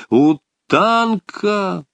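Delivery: high, drawn-out call of a name. A narrator's reading. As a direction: call to him, stretching the name. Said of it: "он крикнул подвижнику просто".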